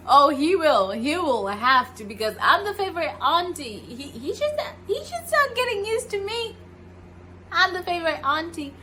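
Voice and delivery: teasing, proud auntie voice; playful boast. Retold or imitated. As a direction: brag half-jokingly about being the favourite.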